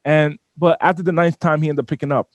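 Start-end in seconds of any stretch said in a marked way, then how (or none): none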